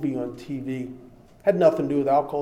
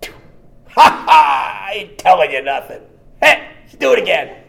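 loud mocking laugh and shout, much louder and higher; cocky laughing spy; 5 semitones higher, 10 dB louder than usual. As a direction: laugh in their faces, daring them to go on. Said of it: "spy laughs under the drip".

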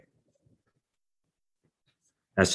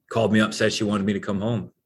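ordinary talk, nothing marked

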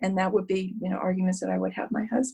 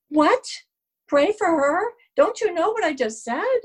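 much higher, outraged questions; indignant disbelief; 8 semitones higher than usual. Retold or imitated. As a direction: throw back the advice in disbelief. Her outraged reply to sponsor.

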